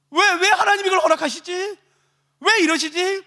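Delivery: high, insistent bewildered protest; anguished questioning. Acted. Persecuted believer asking why God allows it, distressed. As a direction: demand to know why. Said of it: "그 수많은 갈등".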